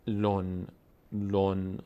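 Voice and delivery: ویلون drawn out, then لون repeated on a level pitch; slow demo of the n ending in ویلون and لون; tanween sounded as nun. Shown as a demonstration. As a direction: hold لون evenly and close on a clear n.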